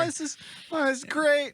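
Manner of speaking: put-on very high-pitched, delighted kid voice; mock thrilled. Boy in the air on his dragon, thrilled. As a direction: imitate a boy loving the ride. Acting Morty enjoying the flight.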